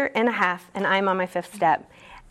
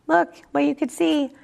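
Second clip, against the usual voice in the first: lightly voiced higher power, pitched high and gentle; 8 semitones higher than usual. Higher power calling to Amy, lightly voiced and high. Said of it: "Harry Power might have been like".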